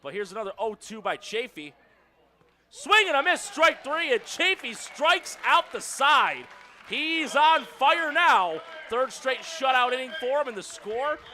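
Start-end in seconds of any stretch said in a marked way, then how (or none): 2.7-10.5 loud, high, triumphant strikeout call; big drawn-out punches on the key words; excited play-by-play shout. Real, not acted. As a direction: shout it as the pitcher finishes off the side. Calls striking out the side.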